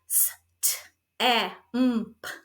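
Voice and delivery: each letter named apart, s, t, e, m, p. Shown as a demonstration. Teacher modelling a student naming each letter separately. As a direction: spell it out one letter at a time. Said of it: "They could give you the sound of each letter".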